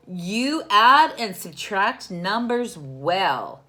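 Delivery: loud, slow, proud praise; proud mom. Acted. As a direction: praise the boy warmly and loudly. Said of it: "said Mom".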